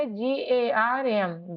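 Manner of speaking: slow letter-by-letter; slow spelling. Shown as a demonstration. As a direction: dictate it slowly. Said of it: "गर्म क्या हो जाएगा". (धीरे-धीरे अक्षर)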